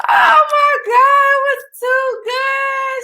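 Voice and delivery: very high, loud, slow squeal; loud, high-pitched raving; overwhelmed gushing. Real, not acted. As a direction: wail with delight, stretching every word. Gushing over the singer's performance.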